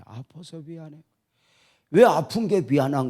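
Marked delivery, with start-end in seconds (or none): 0.0-1.0 much softer, dying away; fading apology. Retold or imitated. Sick wife repeats her apology, fading into near silence. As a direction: let the apology fade to almost nothing.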